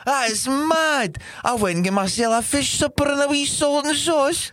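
wander between exaggerated Scottish accents, pitched high; put-on Scottish accent. Shown as a demonstration. Fan marvelling at reaching the semis, high Scottish accent. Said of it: "I'll talk in that accent".

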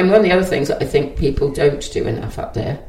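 normal talking voice

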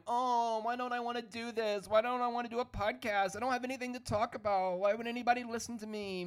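high, fast whiny inner voice; whiny self-doubt. Retold or imitated. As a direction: whine it to yourself in a rush. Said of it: "I kept going".

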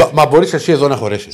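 loud, stammered indignant question; agitated. Real, not acted. Co-host challenges host to fit at the desk, loud and agitated. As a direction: demand it loudly, tripping on the first word. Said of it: "Ηρακλή, ηρέμησε τώρα".

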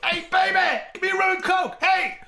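loud, high imitation of a wild stranger hollering; brash bar shout. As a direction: holler it like a flamboyant rock star at the bar.

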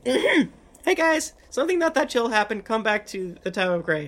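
high excited call to friends; calling through a stone. Acted. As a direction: raise the voice, urgent but playful. Character calls party on sending stone.

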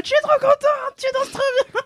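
high, squealing delight with a laugh; excited gushing. Retold or imitated. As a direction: gush like an overjoyed fan. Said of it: "en mode".

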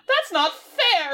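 high, drawn-out complaint; hammed-up teenage grievance; mock whiny protest. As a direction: stretch it out in imitated indignation. Voicing Sarah protesting.

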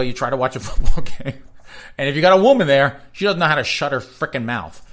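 loud comic exasperation; exasperated rant. Acted. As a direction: rant it loudly and quickly. Exasperated rant about talkative women.

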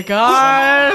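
hammed-up surprise at a visitor; hammed-up excitement. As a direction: act thrilled by the arrival. Staged surprise at jingle bells.

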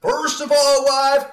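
high-pitched Southern giant husband; Southern accent. Pretend. Giant husband lecturing his wife against vaccines, high mock Southern accent. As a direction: lay down the law in a hammed-up Southern accent. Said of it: "this giant has a Southern accent".

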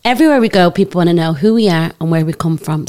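usual speaking voice

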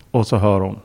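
normal talking voice

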